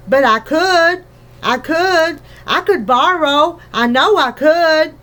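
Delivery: eager insisting. Acted. Daughter insisting to mother.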